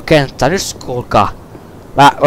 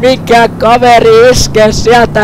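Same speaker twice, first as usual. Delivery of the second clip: higher, slow, announcer-style play-by-play; mock sports commentary; 4 semitones higher, slower than usual. Gamer commentating his own replay like a sportscaster. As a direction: call the replay like a hyped caster.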